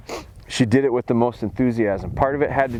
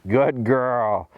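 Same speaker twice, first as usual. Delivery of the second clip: ordinary talk, nothing marked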